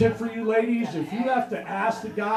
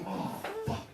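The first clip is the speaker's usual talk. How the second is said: buddy's dismay; 11 dB quieter than usual. Retold or imitated. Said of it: "He goes".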